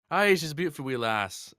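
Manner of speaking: put-on high, loud Scottish accent; hammed-up Scots exclamation; properly Scottish. Playing up the Scottish for fans, thick Scottish accent. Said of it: "going properly Scottish about things".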